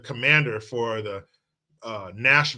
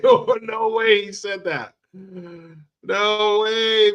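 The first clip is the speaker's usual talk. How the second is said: high, loud, slow no way; mock disbelief; 9 semitones higher, 7 dB louder, much slower than usual. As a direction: act floored with disbelief, pitched way up. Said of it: "I was just like".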